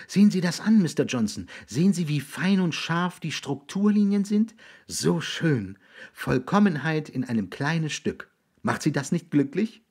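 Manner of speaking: put-on high, delighted boy's voice; enthusiastic boy. Raby showing William a blade of grass, eagerly admiring.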